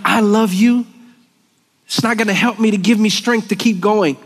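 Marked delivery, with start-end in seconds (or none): 0.0-0.9 higher and louder, rising at the end; earnest declaration. Retold or imitated. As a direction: declare it up and out to God. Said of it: "me telling God".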